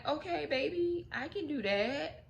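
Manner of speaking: high, soft voice of an agreeable wife; acted compliant wife. Wife agrees sweetly to husband's demand.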